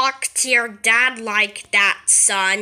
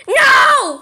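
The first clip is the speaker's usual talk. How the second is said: much higher, louder shriek of no; screamed no; 11 semitones higher, 6 dB louder than usual. Acted. Kid refuses to say goodbye, shrieked no.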